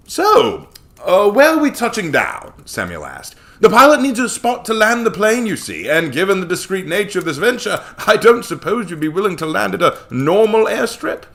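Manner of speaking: loud, high oily businessman; a smug, fawning money man; slimy Samuel. Acted. Samuel smugly pressing them about where to land.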